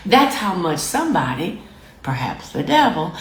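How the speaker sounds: ordinary talk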